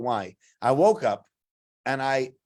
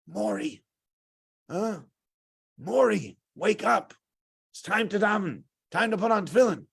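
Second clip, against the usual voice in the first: higher-pitched, gentle rousing of a sleeper; coaxing wake-up call; 6 semitones higher than usual. Retold or imitated. Counselor waking a friend for davening, coaxing. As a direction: nudge someone awake, voice lifted.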